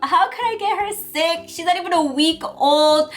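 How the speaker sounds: high, frantic self-blaming wail; a hammed-up tearful meltdown; panicked new mom. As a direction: replay the panic of a new mom who thinks she broke her baby. Retelling her own panic as new mom.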